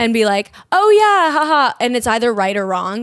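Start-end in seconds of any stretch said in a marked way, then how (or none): 0.7-1.7 loud, high fake agreement with a laugh; mock enthusiasm, pitched way up; pretending to understand. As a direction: overdo a clueless "following along" reaction. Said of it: "pretend you know what they said".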